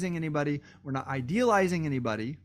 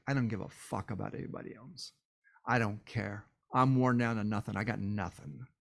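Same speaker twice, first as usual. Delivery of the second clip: low, much softer, drained voice with nothing left; a hollow murmur from someone burned out; worn-out despair; 6 semitones lower, 5 dB quieter than usual. Retold or imitated. A burned-out person giving up on everyone, low and drained.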